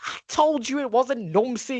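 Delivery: high, slow, emphatic vindication; triumphant insistence. Retold or imitated. Child correcting those who credited Nomsi, triumphant insistence. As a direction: rub it in, drawing the words out.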